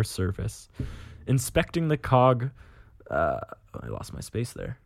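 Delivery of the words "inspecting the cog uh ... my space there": plain reading voice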